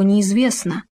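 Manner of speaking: ordinary delivery, nothing marked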